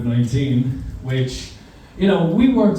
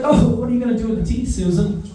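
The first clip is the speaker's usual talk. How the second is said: sweet teacher voice; 7 semitones higher, faster than usual. Retold or imitated. Teacher asks kid sweetly.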